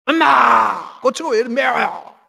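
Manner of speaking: loud mock wail to mom; hammed-up shouted complaint; complaining. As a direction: cry out like a griping child, high and put-on. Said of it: "원망하는 사람이 있어요".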